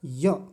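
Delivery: य said loud and clear; stressed य. Shown as a demonstration. Teacher stressing the final syllable, loud and crisp. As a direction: punch the य sound out firmly. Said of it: "य को जब".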